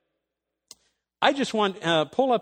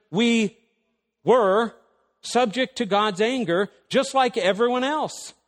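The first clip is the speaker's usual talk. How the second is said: higher, louder pulpit voice driving each point; full-force preaching; 6 semitones higher, 4 dB louder than usual. Acted. Preacher rousing the congregation, a fired-up sermon voice. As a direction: preach it hard to the room.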